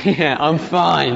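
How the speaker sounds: slow, breezy "Yeah, I'm fine"; mock cheerful fine. The stock churchgoer answer, slowly. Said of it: "What's the answer to that question?".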